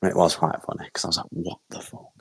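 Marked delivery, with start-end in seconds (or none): 1.3-2.1 voiceless, very soft disbelief; bewildered aside. Retold or imitated. Son seeing the plant pot on fire, hushed bewilderment. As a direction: say it under the breath, imitating shock.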